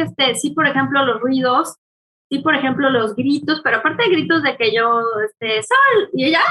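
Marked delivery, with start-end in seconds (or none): 5.6-6.1 high sharp call of a name. Retold or imitated. Mother calling her daughter, high sharp call.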